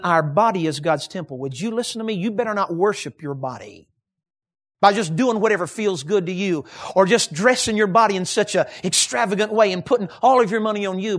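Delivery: ordinary talk, nothing marked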